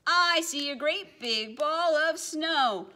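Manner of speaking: high, loud cry of alarm; yelled. Acted. Penguin spotting the snowball, loud alarmed yell. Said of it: "One looked up and yelled".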